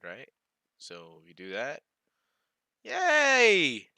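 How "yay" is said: high, loud, drawn-out cheer; excited cheer. Real, not acted. Streamer celebrating working code, high excited cheer. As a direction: cheer as it works at last.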